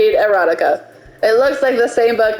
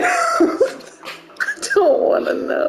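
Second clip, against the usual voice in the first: slow, breathless laugh through the words; 3 dB quieter, much slower than usual. Real, not acted. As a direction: squeal it out between laughs. Laughing at boner joke.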